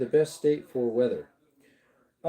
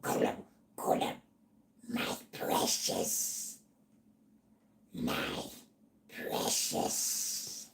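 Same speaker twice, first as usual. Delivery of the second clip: raspy whispered Gollum voice, slow; Gollum impression; 10 dB quieter, much slower than usual. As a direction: hiss out a creepy Gollum "my precious". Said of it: "Any other voice impressions?".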